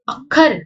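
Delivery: अखर with a doubled kh; with addhak. Shown as a demonstration. Teacher shows अखर with addhak, doubled kh. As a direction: stress the kh in अखर. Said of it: "ट पे जोर पड़ेगा".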